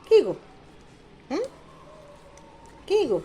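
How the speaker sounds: usual speaking voice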